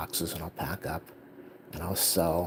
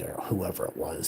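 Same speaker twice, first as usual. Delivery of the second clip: normal talking voice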